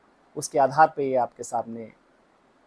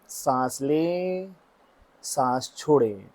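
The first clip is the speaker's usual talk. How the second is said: slow, drawn-out breathing cues with long pauses; guided meditation; 4 dB louder, slower than usual. Real, not acted. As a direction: stretch each cue to pace the listener's breath. Guides breaths before the chant.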